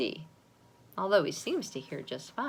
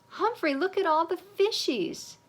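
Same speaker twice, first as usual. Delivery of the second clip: put-on excited child; 11 semitones higher, 4 dB louder than usual. Excited kid at aquarium.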